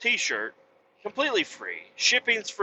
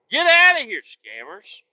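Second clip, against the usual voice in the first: loud, higher yell to shoo them off; shout; 6 semitones higher, 7 dB louder than usual. Real, not acted. Trucker yelling at scammers to get lost, loud shout. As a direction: bark it out like chasing off pests.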